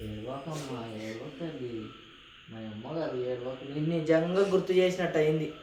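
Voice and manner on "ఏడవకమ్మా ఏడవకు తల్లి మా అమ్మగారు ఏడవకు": soft, hushed comfort; soothing. Real, not acted. Husband hushing his crying wife, softly. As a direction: gently coax her to stop crying.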